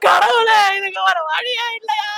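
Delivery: sobbing cry that sinks to a choked moan; crying to God. Acted. Mother crying out to God in despair, sobbing. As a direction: wail to heaven, then break down. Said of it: "நொய் நொய்ன்னு அழுதுகிட்டு".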